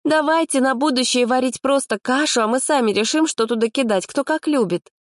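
put-on high, indignant girlish voice; a fussy girl outraged at the porridge. Girl voice continues.

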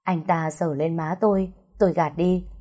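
plain reading voice

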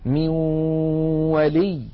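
من ولي recited with the n melting into a long nasal w; ghunna into waw. Shown as a demonstration. Teacher giving the waw example, nasal merge in من ولي. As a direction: hold a nasal w between من and ولي. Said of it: "مع بقاء".

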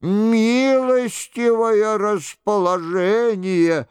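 high, stretched-out flattery; slow, high-pitched fawning; obsequious monk. A narrator's reading.

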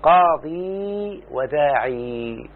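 قاضي and وداعي each held on a very long, slow "ee"; silent, unvowelled ya. Shown as a demonstration. Teacher showing a student the original forms, unvowelled ya. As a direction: stretch both endings of قاضي وداعي, no n. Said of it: "بإسكان الياء".

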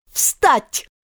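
high, piercing queen's command; piercing shout. A narrator's reading. Queen ordering the gardeners up, piercing shout. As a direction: call out sharply in a high voice. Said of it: "крикнула королева громким пронзительным голосом".

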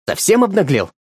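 shouting. Acted. Woman shouts at the old man, indignant. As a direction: hurl the accusation. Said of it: "кричала она".

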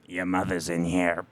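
low, quiet possessed voice; Exorcist demon voice. Retold or imitated. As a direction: growl it like a possessed girl. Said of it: "that was from the Exorcist".

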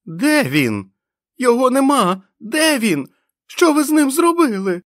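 very high, loud shrieking wail; a madman howling in agony; agonized scream. A narrator's reading. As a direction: scream in anguish for the lost bomb. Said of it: "голос перейшов у зойк агонії".

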